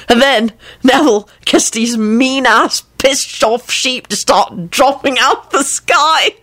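high, slow, halting amusement; breaking up. A narrator's reading. Lupin retelling the sheep spell, helplessly amused. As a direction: stretch it out as if laughter keeps intruding. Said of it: "He couldn't finish the sentence".

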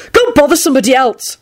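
testy brush-off; angry. A narrator's reading. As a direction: send it packing crossly. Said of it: "he told it angrily".

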